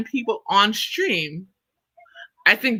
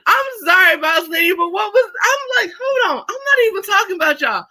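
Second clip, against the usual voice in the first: much higher, half-laughing protest; exasperated high squeal; 11 semitones higher than usual. Real, not acted. Talking back to boss lady in chat, high incredulous laugh-shout.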